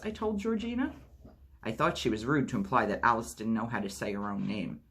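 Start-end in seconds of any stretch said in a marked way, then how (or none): none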